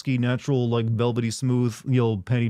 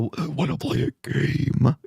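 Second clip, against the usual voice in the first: slow, stretched villain impression; menacing, drawn-out horror villain; Jigsaw; slower than usual. Streamer reprising his horror audition, slow menacing voice. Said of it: "Auditioning for the role of Jigsaw".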